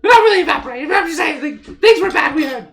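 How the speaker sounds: very high, loud squeaky chatter; squeaky halfling. Acted. Fleeing halfling answers travellers' questions, squeaky and loud. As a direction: talk loudly in a tiny, squealing voice. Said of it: "this kinda squeaky voice like".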